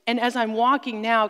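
ordinary talk

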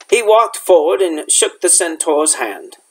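usual speaking voice

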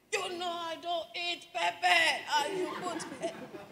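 hammed-up grumpy husband with a laugh; imitating husband. Wife mimicking husband complaining about pepper, mock grumpy. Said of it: "if you just slightly overdo the pepper".

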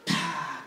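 quick unvoiced gasp; mock gasp for air. Boy surfacing from the baptism water, mock gasp.

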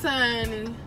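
normal talking voice

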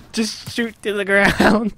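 high, slow words squeezed through laughter; real helpless giggling; laughing. Real, not acted. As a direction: crack up while describing the flop. Laughing at failed launch.

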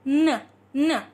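"N" as a bare ending consonant, hummed then released; isolated N sound. Shown as a demonstration. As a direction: sound out "N" alone, not the letter name. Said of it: "We're pronouncing the ending".

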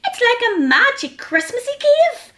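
high-pitched hedgehog wonder; high, lilting storybook amazement; excited cry. A narrator's reading. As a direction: marvel out loud in a little creature's voice. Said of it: "cried little Hedgehog".

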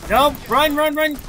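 urgent, loud and high; excited shouting. Real, not acted. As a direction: yell at units to flee. Player panics as bugs attack.

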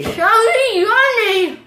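slow, loud, strained, mouth crammed; cheeks stuffed full. Real, not acted. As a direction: force it out past a crammed mouth. Four marshmallows, strained attempt.

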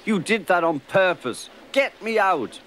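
loud, high accusing protest; accuse, then demand loudly to be freed; indignant outburst. A narrator's reading. Choked engine accuses, demands rescue.

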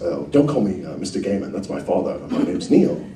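soft, lightly voiced modest author; understated impression of a polite writer; Neil Gaiman. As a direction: gently wave off the formality, imitating a writer. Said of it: "And he goes".

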